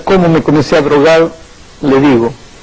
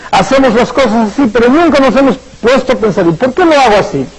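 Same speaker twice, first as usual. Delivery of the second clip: higher, driving sermon cadence; fervent preaching; 7 semitones higher than usual. Acted. Pastor challenges the congregation's inherited beliefs, fervent and higher. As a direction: lift the congregation with conviction.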